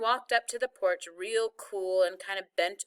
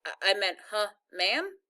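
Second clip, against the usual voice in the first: ordinary delivery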